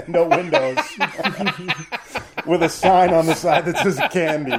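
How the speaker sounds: high, loud, laughing through each phrase; laughing joke. Real, not acted. Host joking about a creepy van, laughing. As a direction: crack up at your own dark joke.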